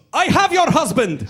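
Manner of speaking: slow, high, booming mock accent; accented cab driver. Cab driver answers the wife's call, shouting in a thick mock accent. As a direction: shout it like a kidnapper's announcement. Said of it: "he answers, he goes".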